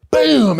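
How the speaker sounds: high, loud impact shout; sudden bam. Retold or imitated. Teller acting out hitting his head, high loud bam. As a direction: blurt the hit like a crash sound. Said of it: "I went".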